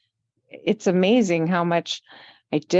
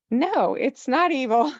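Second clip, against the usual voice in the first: higher-pitched, amused objection; playful retold protest; 8 semitones higher than usual. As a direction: push back in mock protest, pitched up. Acts out defending skulls, then laughs.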